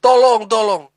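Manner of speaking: brief acted call for help. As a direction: act out the child shouting for help.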